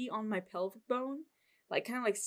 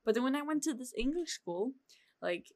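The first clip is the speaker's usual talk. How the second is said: ordinary talk, nothing marked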